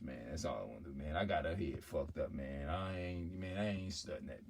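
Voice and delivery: low, soft dismissive brag; mumbled-down macho boasting, acted; man talking down a woman. Man bragging to a female about another woman, low and offhand. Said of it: "if a man go to another female and say".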